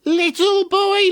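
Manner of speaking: slow, high, far witch croon. Acted. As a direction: coax him like an old witch. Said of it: "every day she said".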